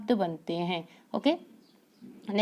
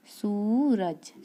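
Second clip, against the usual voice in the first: drawn-out word; much slower than usual. Shown as a demonstration. Teacher sounds out Suraj for children, very slowly. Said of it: "क्या हो जाएगा इसका सार्थक शब्द".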